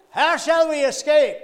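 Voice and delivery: high, loud ringing cry; preacher's echoed shout. Retold or imitated. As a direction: re-enact the preacher's booming line. Echoing the preacher's text again.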